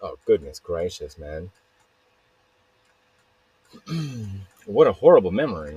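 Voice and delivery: usual speaking voice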